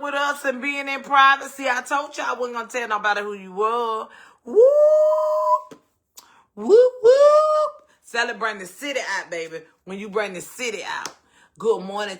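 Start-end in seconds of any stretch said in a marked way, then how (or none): 4.4-7.7 very high, loud whoop whoop; a joyful party holler; celebratory whoop. Real, not acted. Host cheers for her mama's dialysis center, high whoop.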